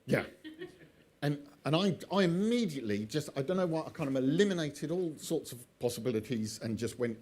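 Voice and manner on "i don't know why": plain reading voice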